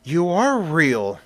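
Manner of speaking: stunned disbelief, drawing the words out slowly; awed gasp. Acted. Christina, waking in the lair, stunned to find him real.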